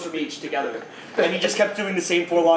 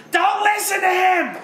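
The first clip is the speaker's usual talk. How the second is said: high, drawn-out mock scream; 3 dB louder, much slower than usual. Woman behind the ferry preacher warning everybody, screaming. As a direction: shriek it like a crazy heckler, loud and slow. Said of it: "you just hear him scream".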